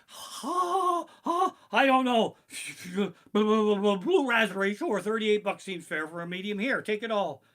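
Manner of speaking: high, bewildered, stammering voice; startled vendor. Retold or imitated. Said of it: "I'm like".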